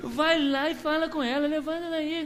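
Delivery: high, laughing jeer; mocking taunt. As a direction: scoff at him with a laugh. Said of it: "alguém deve ter falado".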